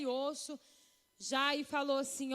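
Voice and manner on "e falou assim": ordinary talk, nothing marked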